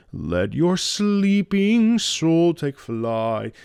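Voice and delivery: slow sung line, leaning on "sleeping soul"; weight the words "sleeping soul"; stress on sleeping soul. Shown as a demonstration. Vocal coach stresses "sleeping soul" in the phrase. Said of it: "Sleeping soul is the key there".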